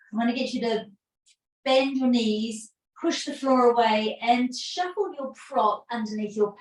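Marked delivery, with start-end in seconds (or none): none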